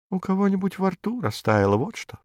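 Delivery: тихий грустный голосок, затихающий; печально, почти шёпотом к концу; тихо и грустно. A narrator's reading. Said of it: "говорит тихим голосом, грустным голосом". (кролик тихо и грустно отвечает)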